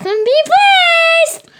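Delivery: high, loud happy sign-off; forced cheerful. Shown as a demonstration. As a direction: say it brightly on request. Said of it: "Say it like you're happy".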